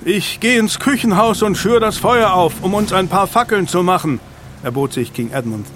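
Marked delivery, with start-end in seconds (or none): 0.0-4.2 high, raised storm voice; shouted offer. A narrator's reading. Man offers to light torches, shouting over the storm. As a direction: call it out over howling wind.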